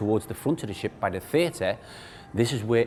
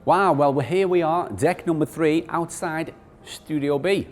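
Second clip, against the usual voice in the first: upbeat cruise director; 6 semitones higher, 5 dB louder than usual. Real, not acted. New deck intro, loud.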